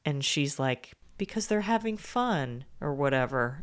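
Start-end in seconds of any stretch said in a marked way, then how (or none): 1.2-2.6 higher, softer retort; mock defensive mom. Ex-wife answers the angry dad at the door, mock defensive. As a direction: lightly imitate the ex-wife answering back, pitched up.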